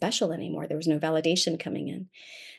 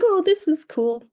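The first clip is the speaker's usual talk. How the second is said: mock delight; 9 semitones higher than usual. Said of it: "I was like".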